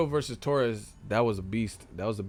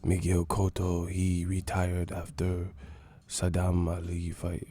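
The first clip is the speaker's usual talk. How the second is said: put-on low, slow, accented mumble; imitating a subdued boxer interview; Miguel Cotto impression; 4 semitones lower than usual. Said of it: "Cotto be talking in third person".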